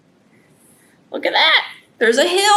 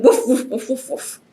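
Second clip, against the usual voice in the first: breathy mock woofs, fading away; dog barking. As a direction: bark lightly like a storybook dog.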